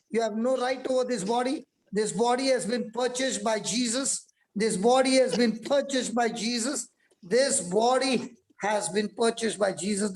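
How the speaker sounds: deliverance prayer. Acted. Commanding sickness to leave.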